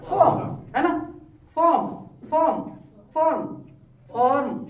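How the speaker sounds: फॉर्म with a barely touched r; फॉर्म said with a soft, faint r; soft r demo. Shown as a demonstration. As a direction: keep the r of फॉर्म light. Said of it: "रॉ का sound हल्का होना चाहिए".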